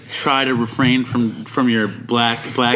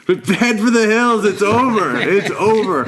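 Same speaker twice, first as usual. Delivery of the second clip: high, slow, drawn-out alarm; hammed-up call to flee; mock panic; 7 semitones higher than usual. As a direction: cry out doom in exaggerated panic. Acting out his own panic.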